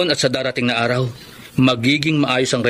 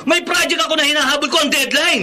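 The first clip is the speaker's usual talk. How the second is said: higher, insistent self-defense; heated defense; 12 semitones higher than usual. Acted. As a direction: argue your side hard. Husband defends himself heatedly. (mainit na pagtatanggol)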